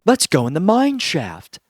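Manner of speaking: mock eager teens. Teens' dumb idea voiced.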